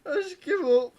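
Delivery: high, squeezed-out words through laughter; helpless laughter. Real, not acted. Helpless laughter after nickname joke.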